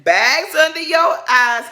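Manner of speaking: slow, high shouted insult; mock shout. Quoting a housewife's shouted jab at a rival, slow loud yell. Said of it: "And then K Michelle said".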